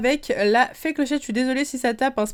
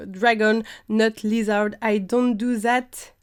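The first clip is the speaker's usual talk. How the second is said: English read with a French accent; accent français en anglais; slower than usual. Real, not acted. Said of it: "ne jugez pas mon accent anglais". (anglais lu avec un accent français)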